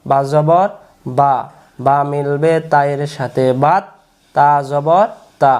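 drawn-out, measured syllables; slow spelling drill. Shown as a demonstration. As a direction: spell it out slowly for pupils to repeat. Spelling drill repeated for learners. (টেনে টেনে ধীরে বানান)

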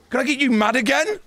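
put-on high teasing baby-talk question; mock-sweet high taunt. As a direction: needle him in a high voice. Taunting enemy.

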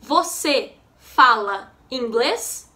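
slow teaching repeat with rising end; learner-paced question, pauses between words. Shown as a demonstration. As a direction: ask it slowly, separating each word.

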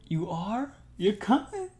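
can't believe it, squeal the question; thrilled disbelief. Real, not acted. To partner who just agreed to come, a high overjoyed squeal. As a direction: voice jumps high and loud in joyful surprise.

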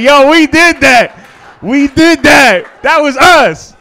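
loud, high, hyped-up bragging; triumphant hollering, cracking up; hyped shout. Retold or imitated. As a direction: cheer it like your team just scored.

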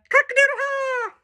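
a high rooster crow laugh. Acted. Voicing farm animals in book.

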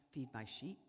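Jesus softly telling Peter; soft command. Retold or imitated.

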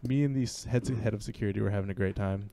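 ordinary talk, nothing marked

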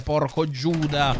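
ordinary delivery

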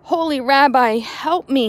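loud, high plea to the rabbi; desperate scream. Acted. Poor man begging the rabbi for help, screamed. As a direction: cry out for help at the top of your voice. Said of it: "he screamed".

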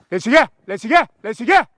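loud, rising call across the field; calling the dog. Real, not acted. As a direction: shout the dog's name to bring her back.

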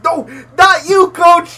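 high, loud, drawn-out mock despair; dismayed shout. Listener reacting to Coach starting the accent, dismayed shout. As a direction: shout as if betrayed.

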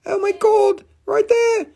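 very high, squealed astonishment; shocked outcry. Acted. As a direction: squeal it out in high-pitched disbelief. Mock shock at spotting a bubble.

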